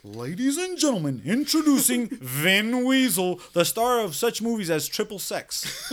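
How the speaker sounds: high, slow hammed-up ring announcer; mock announcer. Greeting his friend Vin like a ring announcer, hammed-up and slow. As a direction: introduce him like a boxing MC. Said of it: "Whenever I see this motherfucker, I'll be like".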